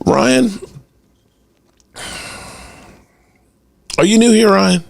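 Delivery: pointed high challenge; high mocking challenge. Real, not acted. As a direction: call him out, incredulous. Calling out a commenter.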